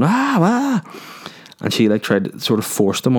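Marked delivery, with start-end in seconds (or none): 0.0-0.8 high mock panicked yelps; panicked cries. Mate on stage panicking, high mock yelps. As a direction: squeal like a terrified mate. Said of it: "extremely panicked, like, going".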